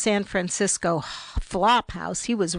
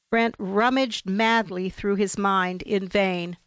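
ordinary delivery

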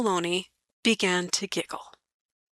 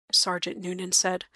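plain reading voice

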